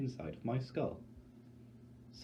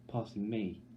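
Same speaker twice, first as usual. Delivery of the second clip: ordinary delivery, nothing marked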